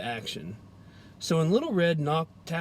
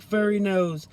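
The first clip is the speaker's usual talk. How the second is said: ordinary delivery, nothing marked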